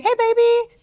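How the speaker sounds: high-pitched, affectionate hello; sweet girlfriend greeting. Retold or imitated. Girlfriend answering boyfriend's call.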